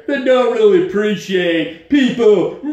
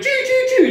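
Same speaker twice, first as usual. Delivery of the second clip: much higher, shouted choo-choo with a laugh; laughing train noise. Retold or imitated. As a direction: cheer the car on like a train. Gunning the car uphill.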